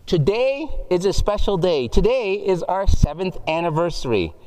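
cheery loudspeaker announcer voice. Acted. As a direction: boom it out like a supermarket PA. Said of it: "a voice comes through the speaker".

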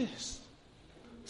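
hushed, slow. Shown as a demonstration. Preacher hushing the room before the Lord speaks. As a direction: drop the voice and slow down before the reveal. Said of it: "repeats phrase".

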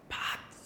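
a soft whispered trumpet sound; mock trumpet fanfare, whispered; breathy imitated trumpet blast. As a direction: make a hushed trumpet call.

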